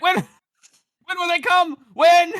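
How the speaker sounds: high, slow, loud whine; pleading heckler. Acted. Heckler presses the king on when help comes, high desperate whine. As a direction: demand an answer desperately. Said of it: "And he's like".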